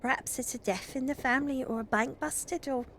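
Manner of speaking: high, gossipy Scouse servant; Scouser maid. Acted. Maid Ruth brings bad news to Mother, high-pitched Scouse. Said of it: "maid always has, like, a Scouser accent".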